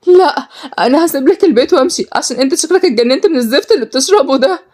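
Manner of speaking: high, indignant woman's voice; angry defiance. Acted. Sister threatening to leave the house, angry. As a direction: defy him, pitch pushed up. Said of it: "مشيت غالية بكل غضب".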